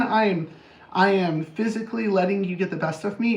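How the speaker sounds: ordinary talk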